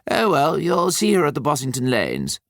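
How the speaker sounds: a hint of Nicholas, gently pushing; Nicholas's voice. A narrator's reading. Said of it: "said Nicholas".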